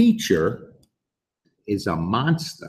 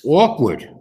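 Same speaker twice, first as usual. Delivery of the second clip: Awkward said louder and higher, drawn out; awkward emphasized; 6 semitones higher, 5 dB louder than usual. Shown as a demonstration. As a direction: lean hard on Awkward. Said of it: "what does awkward mean?".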